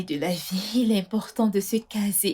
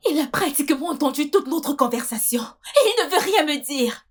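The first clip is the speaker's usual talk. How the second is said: raised, higher voice, upset and worked up; agitated distress; 5 semitones higher, 4 dB louder than usual. Acted. Wife telling her friend about the overheard call, distressed. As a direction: blurt it out in rising distress.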